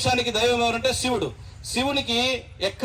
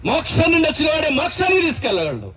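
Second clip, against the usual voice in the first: rousing preaching cry; 6 semitones higher than usual. Real, not acted. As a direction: declare it like the climax of the sermon. Preacher's climax line.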